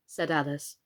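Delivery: ordinary delivery, nothing marked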